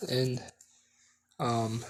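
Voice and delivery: usual speaking voice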